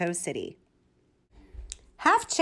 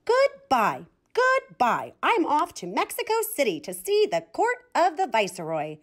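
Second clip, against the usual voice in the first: higher, vain little chicken crowing goodbye; proud farewell; 6 semitones higher than usual. A narrator's reading. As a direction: announce it like a vain chick, pitched up.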